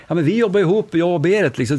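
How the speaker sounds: worried office worker objecting; protesting employee. Retold or imitated. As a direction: push back anxiously. Employee objecting in retold story.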